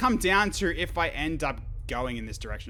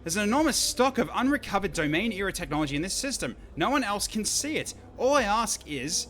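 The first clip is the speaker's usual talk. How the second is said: eager scientist; 9 semitones higher, 5 dB louder than usual. Acted. Voices eager scientist character.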